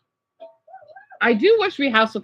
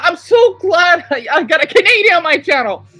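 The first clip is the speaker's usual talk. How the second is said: higher and louder, delighted; thrilled; 6 semitones higher, 6 dB louder than usual. Real, not acted. Vlogger thrilled a Canadian watches, higher louder gush. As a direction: gush about it to the chat.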